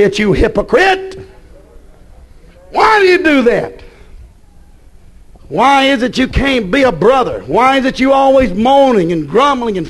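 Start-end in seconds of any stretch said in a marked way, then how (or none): none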